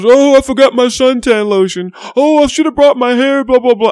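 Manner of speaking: mock the friend's endless forgetting; imitating a scatterbrained friend. Friend mocked in retold story.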